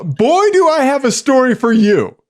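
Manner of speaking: mock-excited announcement; all-caps excitement. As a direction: read it like it's typed in capitals. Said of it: "Ian texted all caps".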